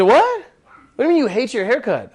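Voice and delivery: very high, loud shocked "what"; a barber who can't believe the customer; stunned disbelief. Retold or imitated. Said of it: "I said".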